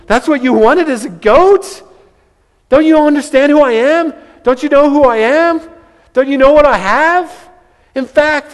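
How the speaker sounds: high, loud disbelief at the goat; incredulous father. Retold or imitated. Father asking his older son if he's serious, incredulous. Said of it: "I imagine the father's like".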